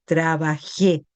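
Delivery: trabajé slow, with the final é clearly stressed; stress on the accent. Shown as a demonstration. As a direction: lean slowly on the é of trabajé. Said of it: "Esto es con acento, acuérdense". (acento marcado en la é de trabajé, despacio)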